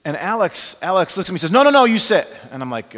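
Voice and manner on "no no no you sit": higher, much louder, insisting; insistent call. Retold or imitated. Cuban friend insisting the pastor stays, loud and insistent. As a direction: urge him to stay put, firm and loud.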